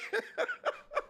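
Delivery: high giggling laugh; real high giggle. Real, not acted. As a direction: giggle at the joke. Laughing at own theater rant.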